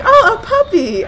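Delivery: loud, very high-pitched coo; mock delighted squeal. Player retelling first sight of a supposed puppy, delighted squeal. Acting out first reaction to rat.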